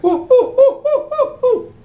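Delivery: high, loud mock monkey hoots. Gorilla suit guy hooting at the crowd for help. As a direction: whoop it up like an ape to get noticed. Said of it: "He's like".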